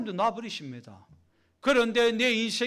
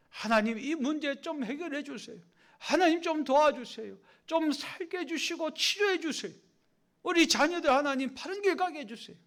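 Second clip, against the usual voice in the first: higher, begging voice to God; pleading prayer; 6 semitones higher than usual. Pretend. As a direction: plead like a desperate believer.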